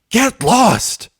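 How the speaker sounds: high, loud spiteful yell; a sudden furious outburst. A narrator's reading. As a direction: bark it to drive her out. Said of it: "Rainbow was yelling now".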